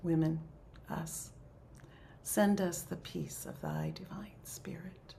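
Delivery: measured, reverent recital with long pauses; slow prayer. Real, not acted. Leader recites closing prayer for the circle, slow prayer. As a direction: pray slowly, letting each phrase come in. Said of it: "I'll try to say it slowly".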